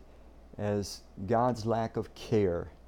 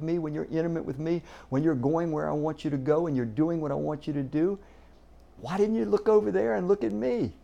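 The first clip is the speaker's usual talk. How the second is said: higher, exasperated rebuke; a little irritated; 5 semitones higher than usual. Retold or imitated. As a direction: chide them like a let-down teacher. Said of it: "That sounded a little irritated".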